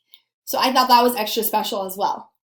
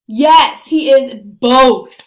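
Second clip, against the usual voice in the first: emphatic answer; 8 dB louder, much slower than usual. Real, not acted. Teacher answering her quiz.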